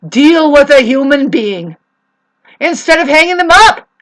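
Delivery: angry outburst. Real, not acted. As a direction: hammer it out at full volume. Angry rant at the neighbours.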